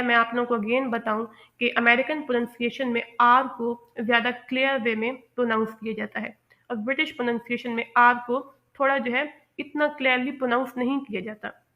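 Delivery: ordinary talk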